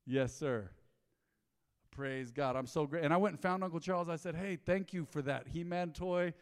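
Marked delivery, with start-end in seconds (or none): none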